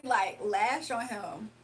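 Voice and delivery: usual speaking voice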